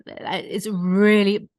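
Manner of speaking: slow creaky fry; vocal fry. Retold or imitated. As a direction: drag it out in heavy fry. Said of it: "this vocal fry".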